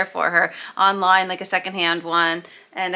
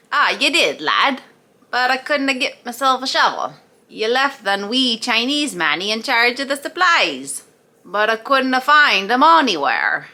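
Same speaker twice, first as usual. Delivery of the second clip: broad Scottish brogue, a bit louder and higher; Scottish accent; 4 semitones higher, 3 dB louder than usual. Acted. Scotsman answers foreman in thick Scottish accent. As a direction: play the burly Scots labourer grumbling in broad Scots. Scotsman in joke, couldna, wee.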